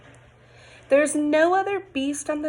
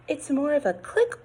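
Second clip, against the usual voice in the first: plain reading voice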